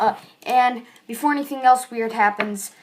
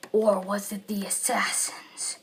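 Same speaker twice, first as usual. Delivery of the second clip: dramatic whisper; 3 semitones lower, 7 dB quieter, slower than usual. Acted. Dramatic quote of the humans.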